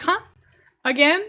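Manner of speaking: confused huh. Retold or imitated. Dutch waiter not understanding her.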